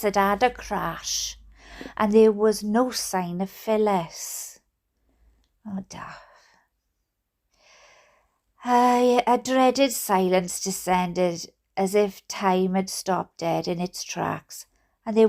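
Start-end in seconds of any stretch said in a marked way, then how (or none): none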